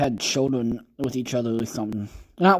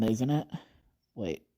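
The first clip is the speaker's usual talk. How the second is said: usual speaking voice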